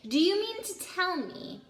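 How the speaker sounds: a giant growling in disbelief; growled. A narrator's reading. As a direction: challenge them, barely holding back rage. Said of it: "he growled at the Dursleys".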